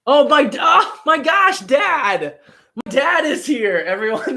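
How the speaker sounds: high, loud, thrilled outburst; delighted surprise. Real, not acted. Streamer spotting dad among the viewers, delighted surprise. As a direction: cry out in happy astonishment.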